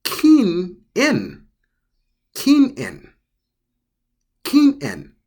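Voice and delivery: keen in with a clear break after keen; keen in in two separate parts, high and clear; Japanese pronunciation. Shown as a demonstration. Teacher showing the apostrophe word for non-smoking, careful demo. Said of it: "you could say it as".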